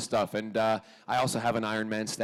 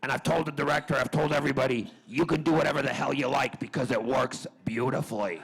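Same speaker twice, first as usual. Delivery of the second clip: big producer voice, higher and louder; Aaron Spelling; 4 semitones higher, 4 dB louder than usual. Retold or imitated. Imitating a producer phoning his actor, emphatic and approving. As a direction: play a loud boss heaping praise on an actor. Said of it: "Aaron Spelling rang the next day and he said".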